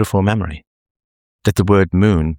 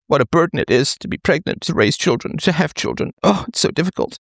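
higher-pitched, exaggerated moaning about hardship; mock overburdened complaint; 8 semitones higher than usual.

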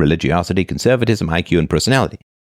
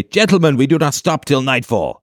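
rally the fellowship like a king; rallying epic command; 8 semitones higher than usual. Acted. Mock-Aragorn urges his companions onward, commanding.

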